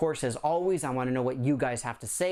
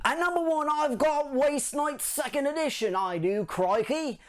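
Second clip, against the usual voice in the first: higher, hammed-up Australian accent; a cheesy mock Australian announcer; bad Australian accent; 11 semitones higher than usual. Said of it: "can do a bad Australian accent".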